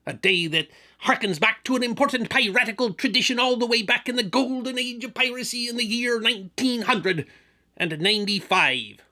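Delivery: gruff swaggering pirate. Acted. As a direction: growl it like an old sea dog. Continues pirate character.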